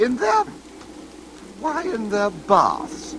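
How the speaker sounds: high alarmed, slowing question; sudden high startled query. Acted. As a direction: caught off guard, puzzled alarm. Startled by odd remark.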